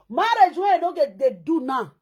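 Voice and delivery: ordinary talk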